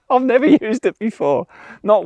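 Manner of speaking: high sheepish laugh into the words. Real, not acted. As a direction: laugh through the line, embarrassed. First time using camera feature.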